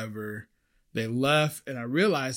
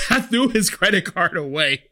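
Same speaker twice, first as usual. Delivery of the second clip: louder, higher, breaking out of a laugh; laughing confession; 5 semitones higher, 7 dB louder than usual. Real, not acted. Server confessing to viewers what he did with the card, laughing. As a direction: confess it through real laughter.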